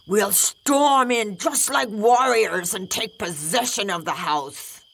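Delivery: rally the troops lightly; bold rooster. Acted. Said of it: "The bold rooster said".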